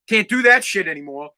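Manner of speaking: ordinary talk, nothing marked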